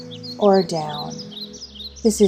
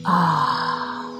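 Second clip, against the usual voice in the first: long open-mouthed "ah" sigh; big airy exhale; lion's breath exhale; 2 semitones lower than usual. Shown as a demonstration. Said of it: "release the breath through your mouth".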